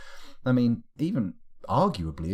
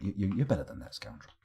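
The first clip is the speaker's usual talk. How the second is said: normal talking voice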